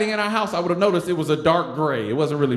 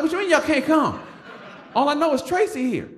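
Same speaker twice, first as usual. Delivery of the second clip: incredulous; 10 semitones higher than usual. Retold or imitated. Cousins can't come.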